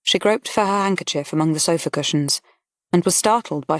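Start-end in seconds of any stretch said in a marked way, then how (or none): none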